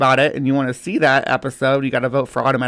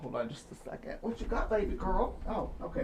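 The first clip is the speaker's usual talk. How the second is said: murmured, much softer, turned away; quiet aside to dog; 3 semitones higher, 17 dB quieter than usual. Real, not acted. Vlogger to his dog at his feet, soft aside. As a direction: softly check on the dog by your feet.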